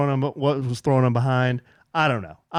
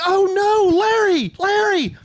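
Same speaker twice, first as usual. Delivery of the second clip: high, alarmed cry after a dog; panicked calling; 4 dB louder than usual. Retold or imitated. Owner crying out after his escaped dog, high panicked call. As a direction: yelp the name in dismay, high-pitched. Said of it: "I'm like".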